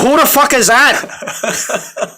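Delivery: put-on Irish accent. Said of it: "interview you as Conor McGregor".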